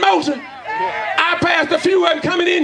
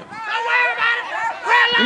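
breezy don't-worry voice, pitched up; soothing brush-off in a put-on high voice; mock reassurance; 7 semitones higher than usual. Mock reply to his own question.

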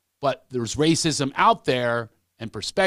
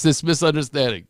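ordinary talk

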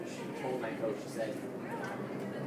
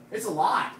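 loud, high-pitched shout; 10 semitones higher, 14 dB louder than usual. Real, not acted. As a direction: belt it out to fire them up. Peak of pep talk.

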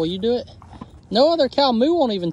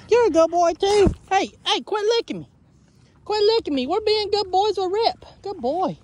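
doting pet voice; 8 semitones higher than usual. Real, not acted. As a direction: coo at the animals like babies. Doting on the cows.

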